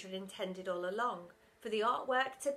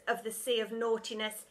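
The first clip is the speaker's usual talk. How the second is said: ordinary delivery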